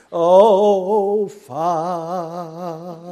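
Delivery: breathy, overly reverent prayer tone; mock holy prayer voice, oh Father; put-on prayer voice. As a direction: puff yourself up like a pious pray-er. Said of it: "they prayed in a prayer voice".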